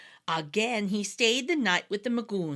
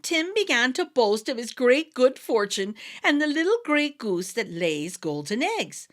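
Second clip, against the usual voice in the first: ordinary delivery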